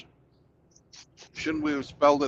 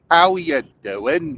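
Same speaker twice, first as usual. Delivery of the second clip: slow, loud mock Cockney; drawn-out stage Englishman; mock Cockney accent; mock British accent; 3 semitones higher, 7 dB louder, slower than usual.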